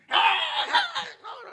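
mock shriek. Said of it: "Jesus didn't scream".

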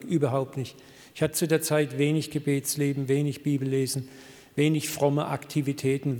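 usual speaking voice

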